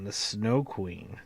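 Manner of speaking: ordinary delivery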